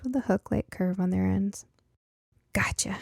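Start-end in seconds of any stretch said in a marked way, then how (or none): 2.5-3.0 breathy triumphant whisper; whispered gotcha. A narrator's reading. Girl fixing the radio whispers to herself in triumph.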